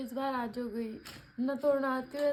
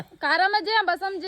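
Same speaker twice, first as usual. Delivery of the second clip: very loud, raised-pitch shout; calling out; 5 semitones higher, 11 dB louder than usual. Real, not acted. Woman calls out motorcycle travel plans, loud shouting. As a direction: holler it so it carries.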